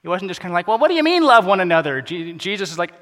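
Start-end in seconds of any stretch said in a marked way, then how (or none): 0.7-2.0 high, loud protest; hammed-up baffled question, pitch swooping up; mock incredulous. Preacher voicing a God who doesn't get it, mock incredulous. Mocking a detached God's puzzled reply.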